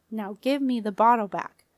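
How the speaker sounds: ordinary delivery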